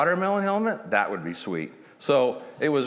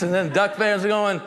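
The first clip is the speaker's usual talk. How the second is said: ordinary talk, nothing marked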